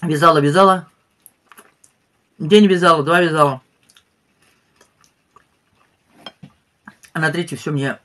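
talking between bites; speaking with food in mouth; mouth full, chewing. Real, not acted. Seen 1: Spoonful taken at 0.8–1.3, chews while speaking after.

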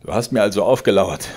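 laut und höher gerufen; empört herausrufen. A narrator's reading. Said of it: "rief Georg". (Georg ruft dem Vater empört zu)

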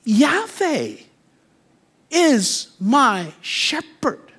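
high, slow, exultant proclamation; David proclaiming. Retold or imitated. David declaring to the congregation, high and exultant. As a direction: lift each word up slowly. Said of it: "And David is saying".

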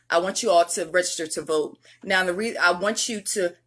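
usual speaking voice

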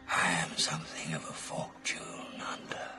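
whisper. Acted. Confides his aim.

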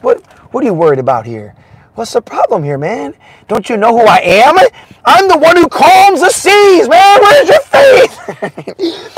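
a calm master scolding, then laughing at their fear; Jesus rebuking. Acted. Jesus scolds frightened disciples, rising to a laughing high shout.

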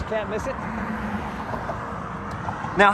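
normal talking voice

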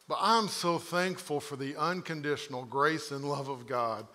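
normal talking voice

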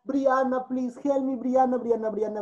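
ordinary talk

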